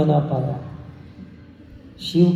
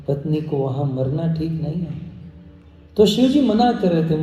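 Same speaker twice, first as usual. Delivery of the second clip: ordinary delivery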